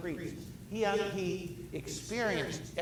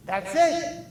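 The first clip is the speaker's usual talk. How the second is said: hammed-up instant understanding; sudden realization; 3 semitones higher, 4 dB louder than usual. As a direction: exclaim as if it all just clicked. Acting out an instant convert.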